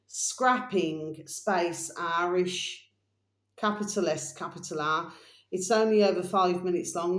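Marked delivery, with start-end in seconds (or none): 0.1-5.1 drawn-out, careful dictation; slow repeat. Shown as a demonstration. Host repeating the uploader name slowly for viewers. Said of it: "I'm just gonna repeat this again for you".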